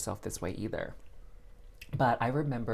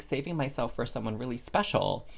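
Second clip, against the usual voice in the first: normal talking voice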